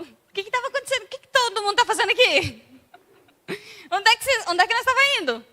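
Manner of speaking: fast and high, bewildered; confused revived boy. Pretend. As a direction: play the boy waking puzzled. (menino confuso, rápido e agudo)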